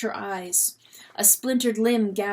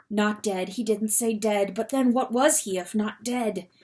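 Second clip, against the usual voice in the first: ordinary delivery, nothing marked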